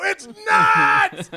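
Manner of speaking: high, drawn-out imitated holler; gleeful class shout. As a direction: holler it like students shouting a running joke. Students all shout the joke.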